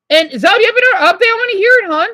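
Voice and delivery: high, loud, rushing eagerness; can't wait to hear the news; excited eager. Real, not acted. Asking viewer Zoe for her update, eager and loud.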